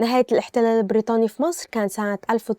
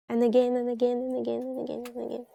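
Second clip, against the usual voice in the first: each and again softer, trailing off to nothing; fading repetition; 7 dB quieter, faster than usual. Shown as a demonstration. Teacher repeating the phrase, fading out. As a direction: let the repeats die away.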